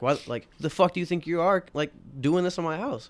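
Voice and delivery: hammed-up angry homeowner; imitating angry homeowner. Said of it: "It's like".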